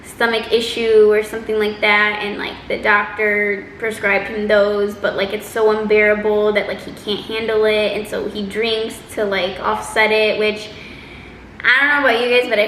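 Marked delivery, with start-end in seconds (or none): none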